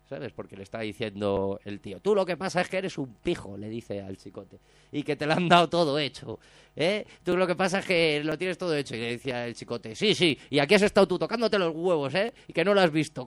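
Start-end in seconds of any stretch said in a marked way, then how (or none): 2.0-8.9 higher and louder mock insult; imitated accusation. Bar owner insulting Chicote. 10.0-13.3 louder, higher heated comeback; imitated angry retort. Chicote snapping back.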